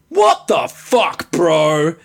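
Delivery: slow, loud, drawn-out "what the fuck, bro"; Doug impersonation. Retold or imitated. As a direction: play Doug barging in, baffled and loud. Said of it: "my best Doug impersonation".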